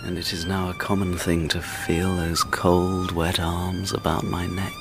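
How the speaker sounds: low, soft, hopeless inner voice; low brooding. Acted. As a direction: brood to yourself in despair. Lonely man broods quietly.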